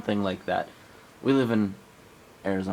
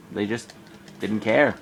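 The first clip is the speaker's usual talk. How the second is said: normal talking voice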